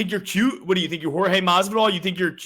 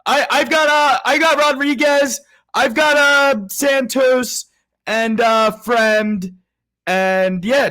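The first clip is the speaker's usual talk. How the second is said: shouting; 6 semitones higher, 7 dB louder, slower than usual. Real, not acted. Shouting picks over noise.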